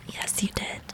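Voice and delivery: hushed, breathy repeat. Acted. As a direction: whisper it softly.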